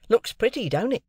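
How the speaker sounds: a lightly voiced city clerk; Wemmick voiced. A narrator's reading. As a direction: play the dry, proud clerk.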